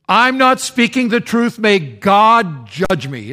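loud, high, then slow oath; desperate oath. Acted. Peter calling down judgment, loud and high. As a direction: swear it out, dragging the end.

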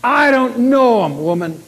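loud, high, drawn-out protest; loud denial. Acted. Peter denying Jesus to a woman, loud and emphatic. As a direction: deny it emphatically, raising the voice.

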